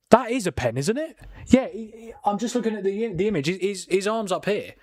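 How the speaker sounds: high, worked-up insistence; arguing the handball with the screen; real excitement, pitch up; excited protest. Real, not acted. Watching the handball replay.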